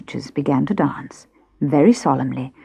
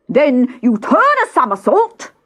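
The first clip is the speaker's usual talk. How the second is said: higher, louder excited yell; 10 semitones higher, 5 dB louder than usual. A narrator's reading. Gryphon explaining the dance to Alice, excited yell. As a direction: holler it with glee. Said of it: "yelled the Gryphon".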